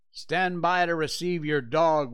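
peremptory order. A narrator's reading. Scott ordering Cherokee's owner to take his dog, loud and curt. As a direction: bark it out as an order that allows no reply. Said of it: "Scott's peremptory order".

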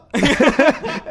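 high real laugh; laughter. Real, not acted. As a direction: burst out laughing. Laughs again.

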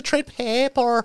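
high, drawn-out silly voice; slow, high-pitched movie-character parody; Waterworld dude impression. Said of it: "sound like the dude from Waterworld".

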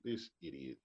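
low, soft contempt; a muttered insult; dry put-down. Retold or imitated. Translating bless his heart.

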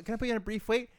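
high sugary customer-service voice; sweet fake politeness for the caller; mock chirpy hold request; polite phone voice.

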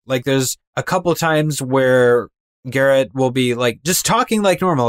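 ordinary talk, nothing marked

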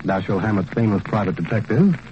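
ordinary talk, nothing marked

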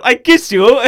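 high, loud eager exclamation; Borat. Acted. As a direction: blurt it out in a comic foreign accent. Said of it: "my name's Borat".

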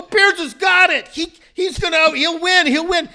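high, loud cheering on; re-enacting a frantic cheer; retold yelling. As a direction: yell it like a sure winner is coming. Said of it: "I started yelling".

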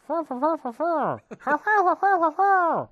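high, loud rhythmic mock panting; imitated neighbor noises. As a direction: pant out repeated high breathy syllables. Imitates noises heard from next room.

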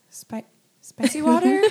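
stammered, rising disbelief; baffled repeat. Retold or imitated. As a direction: repeat it like you can't believe it. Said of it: "literally blank stared and I was like".

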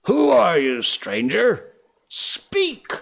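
high, loud, commanding challenge; demanding. Acted. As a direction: demand an answer from the dark. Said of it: "Hook demanded".

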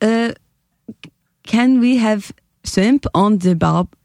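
slow, halting attempt at a Korean accent; tentative put-on South Korean English; careful, word-by-word Korean accent. Shown as a demonstration. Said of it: "a South Korean".

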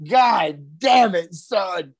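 drawn-out mock exasperation. Real, not acted. Reacts to the voting joke.

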